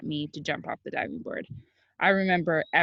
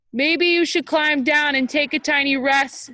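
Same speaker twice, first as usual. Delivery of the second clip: high, loud kindly dad call; gentle dad; 8 semitones higher, 8 dB louder than usual. A narrator's reading. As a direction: coax him down kindly. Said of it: "said his dad".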